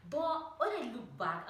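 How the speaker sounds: ordinary talk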